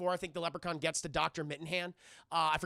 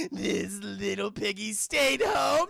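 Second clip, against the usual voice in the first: slow, loud villainous nursery rhyme; taunting leprechaun; 6 dB louder, much slower than usual. Retold or imitated. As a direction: drag out the rhyme to torment a victim. Leprechaun taunts dangling woman.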